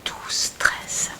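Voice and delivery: hushed, hurried whisper; whispered aside. Real, not acted. One reader whispering to another whose turn it is in English, whispered. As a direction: whisper a quick cue under your breath.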